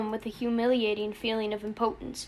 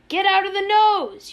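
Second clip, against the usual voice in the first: very loud, high yell; exasperated full-voiced scream; frustrated shriek; 9 semitones higher, 9 dB louder than usual. A narrator's reading. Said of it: "Yossarian shrieked in frustration".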